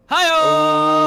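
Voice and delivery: loud, high hi-oh; excited callout. Real, not acted. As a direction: shout it like a rimshot. Joke punchline callout.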